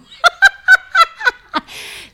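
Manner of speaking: loud, high burst of laughter; shrieking laugh. Real, not acted. Reacting to friend's joke with a shrieking laugh. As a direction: let a big squeal of laughter out.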